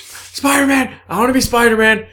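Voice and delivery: high, loud excited yelling; excited shout. Retold or imitated. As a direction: shout it like a giddy kid. Excitedly wishing to be Spider-Man.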